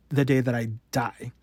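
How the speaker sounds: ordinary delivery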